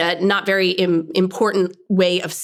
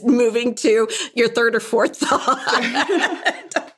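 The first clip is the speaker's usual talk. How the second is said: laughing; 5 semitones higher, slower than usual. Real, not acted. Laughs at shared habit.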